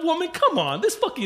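exasperated high whine. Retold or imitated. Husband to wife in a store, exasperated high whine. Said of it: "And I'm like".

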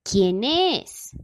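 rough wolf playing the grandmother; hoarse wolf voice. A narrator's reading. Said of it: "la voz tan ronca del lobo". (voz ronca de lobo)

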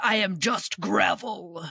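slow mock gravelly tough guy; mock gravel-voiced man. Mocking a caricatured male voice, slow and gravelly. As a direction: lightly play a gruff caricature. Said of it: "There was no, like".

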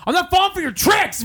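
high-pitched mock outraged lady; suspicious lady. Mimics suspicious lady refusing tickets.

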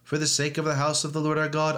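usual speaking voice

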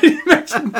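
laughing out the words, high and loud; cracking up mid-question; real laughter bursting through. Real, not acted. Both laughing at the image.

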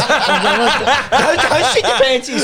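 high, slow mock plea through laughter; high pleading. Retelling the airport bus, the wife pleads high and slow. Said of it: "she's like".

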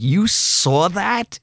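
high, slow stunned disbelief; mock astonished squeak; surprised. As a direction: ask it like you can't believe it. Actor retells fan encounter.

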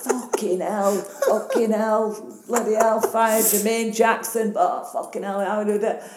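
stunned fan blurting swears; starstruck. Retold or imitated. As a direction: gasp it out, dazzled by a celebrity. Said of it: "Bit starstruck, were you?".

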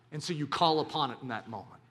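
normal talking voice

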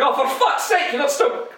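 Scottish mum, fed up and scolding; a mother in a Scottish accent, annoyed at her crying child; thick Scottish accent. Retold or imitated. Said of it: "she gets a thick Scottish accent".

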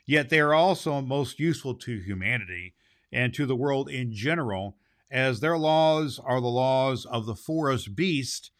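plain reading voice

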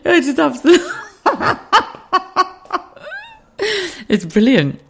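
high, giggling through the words; laughing delight. Real, not acted. Vlogger laughing with delight at Geordie grammar. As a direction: laugh as you gush.

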